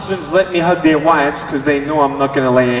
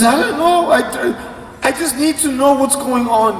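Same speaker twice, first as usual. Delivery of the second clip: high, flustered mom voice; mock a cross mother stumbling for words; angry mother; 8 semitones higher than usual. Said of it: "She's so angry".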